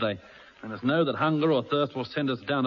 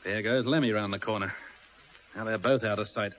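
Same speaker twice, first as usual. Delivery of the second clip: normal talking voice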